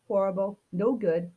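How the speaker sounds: usual speaking voice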